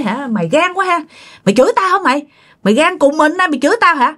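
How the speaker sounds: acted high-pitched telling-off; scolding back. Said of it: "bà chửi lại".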